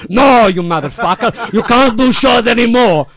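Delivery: put-on dying mentor exploding at the kid; furious correction. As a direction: yell the correction through frustration. Lou furious at misunderstanding.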